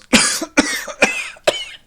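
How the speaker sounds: coughing fit from a hidden man; acted coughing. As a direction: cough repeatedly, in character. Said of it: "all of you hear coughing".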